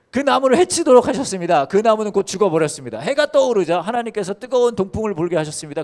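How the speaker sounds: high, loud proclaiming read; preaching scripture. Acted. Pastor reading Jonah to the congregation, loud proclamation. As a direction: declare the verse to the hall.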